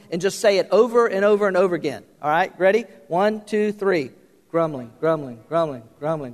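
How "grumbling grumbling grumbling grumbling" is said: low murmured chant of the word; a hint of muttered complaint; demonstrated grumbling. Shown as a demonstration. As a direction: repeat it in a lightly grumbly undertone. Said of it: "start saying grumbling".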